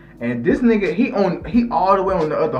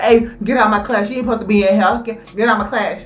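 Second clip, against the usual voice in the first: higher, louder teacher order; stern teacher; 6 semitones higher, 3 dB louder, faster than usual. Retold or imitated. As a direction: order the intruder out of the room.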